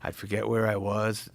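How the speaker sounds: normal talking voice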